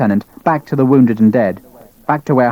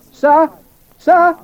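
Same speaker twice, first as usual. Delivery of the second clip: calling out; 4 dB louder than usual. Acted. Private calling out.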